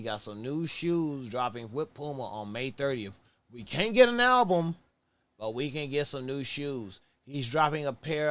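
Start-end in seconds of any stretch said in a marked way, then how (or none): 3.5-4.8 exasperated. Real, not acted. Complaining about no album.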